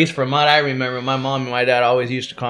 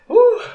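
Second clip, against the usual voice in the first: sudden high whoop from the heat; 6 dB louder than usual. Real, not acted. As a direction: yelp out at the burn. Reacting to hot wing.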